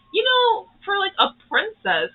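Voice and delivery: a mock high-pitched aside; teasing remark. Wu remarking on her calm.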